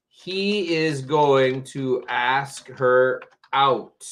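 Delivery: he is going to ask her out, said very slowly word by word; teacher dictates the example sentence at half speed; slow dictation. Shown as a demonstration. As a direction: read it out slowly for the class. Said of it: "I give you a sentence".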